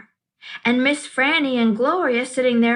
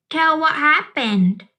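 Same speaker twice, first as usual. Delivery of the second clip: put-on little girl voice; 5 semitones higher than usual. A narrator's reading.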